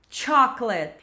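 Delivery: "Chocolate" as "CHOK-lit", two syllables; hard T-SH opening on "Chocolate"; correct, CH start. Shown as a demonstration. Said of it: "como se tivesse um T antes". ("Chocolate" com som de "tch")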